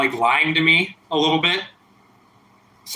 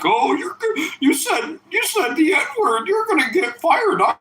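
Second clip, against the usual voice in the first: high mocking tattle voice; mocking tattletale voice; 13 semitones higher than usual. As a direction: squeak it like a snitching kid. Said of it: "these people that are giving me a hard time".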